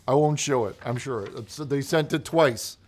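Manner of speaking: usual speaking voice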